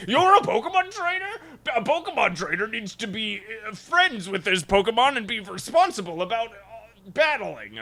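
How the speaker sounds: high-pitched mock old man scolding; yelling old man. Old man lecturing Ash on being a trainer, mock indignant. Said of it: "starts yelling at him".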